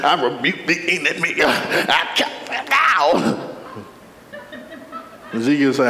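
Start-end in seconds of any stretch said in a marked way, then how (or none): 0.0-3.6 hammed-up holy-roller prayer; mock pious praying, slowing into a shouted rebuke; real holy. Preacher parodies holy believers rebuking the enemy, real holy. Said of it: "many of us will be real holy".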